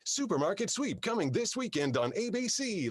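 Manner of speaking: high sing-song announcer lilt; mimicking a computer voice's inflection; copied voice inflection. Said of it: "like, picking her inflection".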